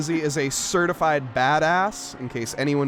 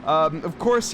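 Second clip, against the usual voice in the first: normal talking voice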